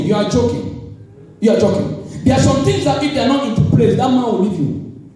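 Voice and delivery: usual speaking voice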